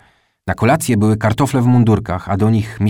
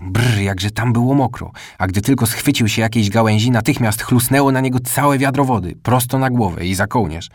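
ordinary delivery, nothing marked

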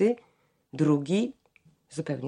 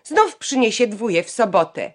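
louder, higher jab, stressing "Znów"; spiteful jab; 5 semitones higher, 8 dB louder than usual. A narrator's reading. Janka sniping at her brother in front of the family, louder and higher. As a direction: needle him with "Znów". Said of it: "Janka rzekła".

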